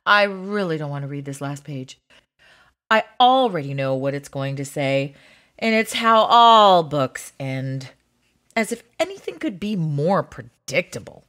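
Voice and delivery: low, quick, deflated grumble; weary resignation. Acted. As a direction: get it over with, all energy gone. Gives in at the last page.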